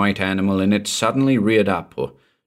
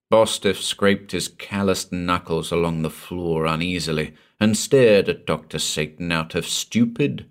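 ordinary delivery

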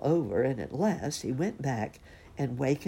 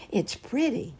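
higher-pitched excited cub voice; 6 semitones higher than usual. A narrator's reading. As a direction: gush like an excited little bear cub. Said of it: "he asked them excitedly".

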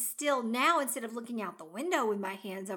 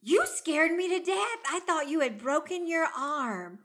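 higher, louder alarmed scolding; 7 semitones higher, 5 dB louder than usual. Retold or imitated. Relieved partner scolding him after the fall, alarmed. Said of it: "And I said".

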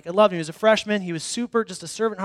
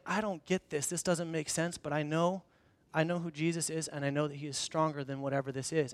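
quiet prayer; 5 semitones lower, 13 dB quieter than usual. Retold or imitated. Humble quiet prayer retold.

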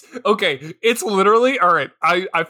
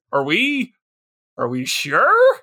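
hesitant kid imitation, halting; tentative question lifting at the end; lightly voiced doubtful child; unsure eight-year-old; 3 semitones lower, slower than usual. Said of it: "the eight-year-old is like".

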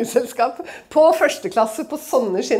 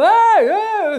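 higher, louder heckling call; impatient shouting; 10 semitones higher, 8 dB louder than usual. Retold or imitated. Russians in the queue shouting impatiently, loud calls. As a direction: yell at the holdup like an angry queue. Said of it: "Så mange begynner å rope".

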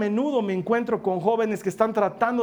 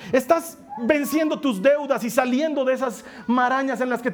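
higher, building preacher's call; rising preaching; 4 semitones higher than usual. Acted. Pastor encouraging the struggling listener, rising preaching. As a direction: lift the congregation, building up.

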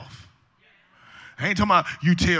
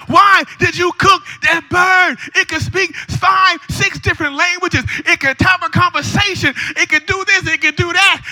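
high, drawn-out mock outrage; hammed-up exasperated wail; acted outraged husband; 12 semitones higher, 7 dB louder than usual. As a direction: play the husband protesting in high-pitched disbelief. Husband in joke learns bird cooked.

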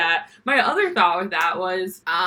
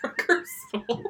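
squeaky, high laughing repeat; laughing echo; 3 dB quieter than usual. Real, not acted. Cohost repeating the punchline, laughing high. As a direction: laugh the words out. Echoing the joke while laughing.

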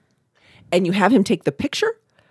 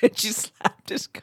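laughing whisper; 5 dB quieter, slower than usual. Real, not acted. Laughing retelling.

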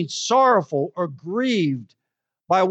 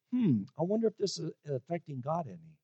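musing aloud; 3 semitones lower, 12 dB quieter than usual. Pretend. Preacher voicing a listener's inner thought.

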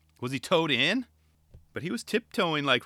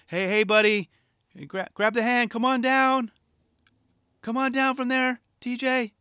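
raised, higher coaxing call to a friend; coaxing a buddy down; 9 semitones higher, 3 dB louder than usual. Pretend. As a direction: talk a buddy down from up high. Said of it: "extend out his hand and say".

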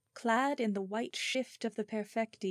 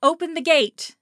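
calling out; 9 semitones higher, 14 dB louder than usual. Acted. Calling up to the castle wall.

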